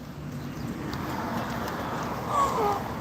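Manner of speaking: long, airy wheeze of laughter; tickled laugh. Real, not acted. Streamer overcome by a chat joke, tickled wheezing laugh. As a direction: laugh until the breath runs out. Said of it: "I'm so damn tickled".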